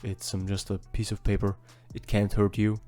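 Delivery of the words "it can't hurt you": usual speaking voice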